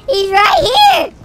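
panicked yelling. Real, not acted. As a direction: yell in real panic as you die. Getting killed by an enemy.

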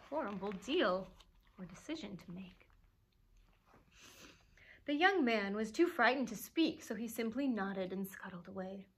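plain reading voice

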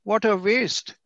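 a high, rueful "what a waste"; mock dismay; disappointed sigh. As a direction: sound let down by it. Lecturer voicing a disappointed remark.

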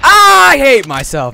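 loud, slow self-disgust; real frustration, drawn out loud and high; frustrated groan. Real, not acted. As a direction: groan at your own mistake, loud. Frustrated after a mistake.

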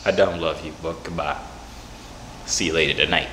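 low, fading sign-off; subdued rejection. Real, not acted. Saying goodbye to a caller who loves them, low and fading. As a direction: drop low and let it tail off.

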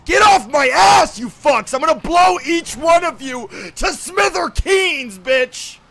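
ranting threat at other players; angry threat. Real, not acted. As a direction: yell at them to back off. Threatens players crowding him.

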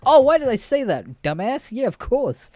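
high, loud exasperated outburst; mock self-scolding. Retelling his slip to a match, self-scolding.

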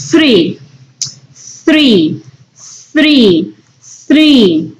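three with the tongue between the teeth; three said slowly with a clear th; th sound. Shown as a demonstration. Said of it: "Este sonido, el T H, suena como".

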